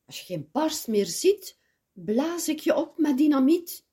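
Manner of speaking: wolf die steeds harder gaat dreigen; dreigende wolf. Acted. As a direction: eindig luid en hoger bij dynamiet. Wolf threatens the pigs. (de wolf dreigt het biggetje, aanzwellend tot luid)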